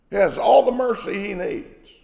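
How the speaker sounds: normal talking voice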